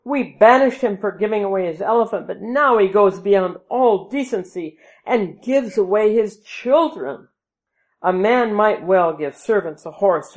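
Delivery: loud, fast outraged tirade; indignant. A narrator's reading. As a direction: rant at the banished prince.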